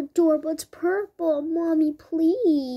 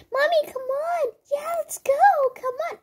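put-on high, eager child's voice pitched way up; pleading little girl tugging at mom; excited little kid; 12 semitones higher, 3 dB louder than usual. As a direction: pretend to be a kid bouncing to leave. Kids urging mom to go.

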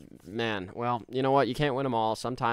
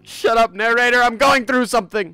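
high, very loud outburst; shrieked melodramatic telling-off; mock furious shout; 12 semitones higher, 15 dB louder than usual. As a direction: yell down an interruption in hammed-up anguish. Yells over narrator during grief act.

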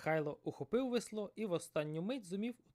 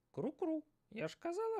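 high squawky seagull voice. Acted. Seagull teasing the fisherman, high and squawky. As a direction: call out like a bird, much higher.

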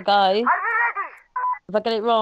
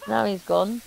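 normal talking voice

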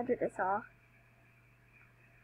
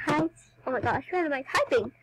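ordinary talk, nothing marked

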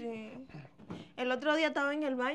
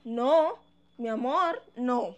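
loud protest; 9 dB louder, slower than usual. Acted. Stopping husband sending sister away.

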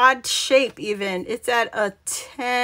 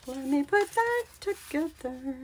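higher, sing-song repeat of the phrase; playful echo; 5 semitones higher, 6 dB quieter than usual. Real, not acted. Crafter talking to herself as she works, playful echo. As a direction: echo it lightly in a raised, soft voice.

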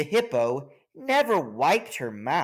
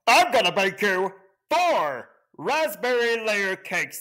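higher, louder bragging voice; boastful frog; 7 semitones higher, 3 dB louder than usual. Acted. The frog brags to the king, high and loud. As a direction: outbid everyone.

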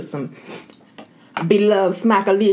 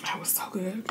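whispering; 14 dB quieter than usual. Real, not acted. After eating the crab.

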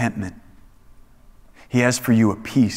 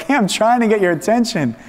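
raised, higher voice calling for attention; imitated shouting; 8 semitones higher than usual. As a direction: call out to get someone's attention. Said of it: "God is shouting to us".

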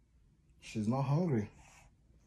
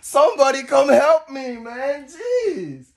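loud, higher, fed-up plea; exasperated cry for help; 12 semitones higher, 14 dB louder, slower than usual. Real, not acted. Dad begging for help with wide-awake baby, loud exasperated. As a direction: call out for rescue, half laughing.

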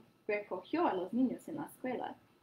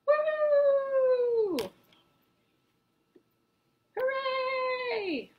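cheer; 7 dB louder, much slower than usual. Acted. Children cheer.

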